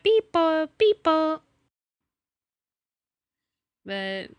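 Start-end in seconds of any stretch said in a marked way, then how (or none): 0.0-1.4 high, loud robot beeps. Pretend. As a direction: beep like a toy robot. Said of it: "I am a robot".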